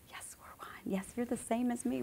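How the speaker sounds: soft whisper, as if face to face; hushed, breath-only agreement; whispered reassurance. Retold or imitated. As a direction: whisper it like an intimate affirmation. Quotes herself reassuring another person.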